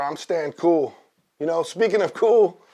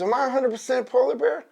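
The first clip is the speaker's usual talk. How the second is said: high little cub voice; baby polar bear; 9 semitones higher, faster than usual. Acted. Cub questioning his dad, high child voice. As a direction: ask it like a small cub, pitched up. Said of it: "he asks his mom".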